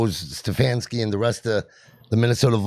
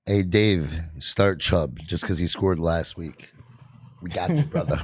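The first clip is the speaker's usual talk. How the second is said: dry mock advice, low and slow; deadpan, not meaning a word; sarcastic; 7 semitones lower, 4 dB quieter than usual. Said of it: "I'm being sarcastic".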